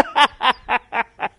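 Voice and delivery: high jeering cackle; mocking laugh. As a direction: crack up at her. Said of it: "cracking up".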